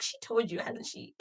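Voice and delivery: hushed, breathy accusation; suspicious whisper. Retold or imitated. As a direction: whisper it to yourself.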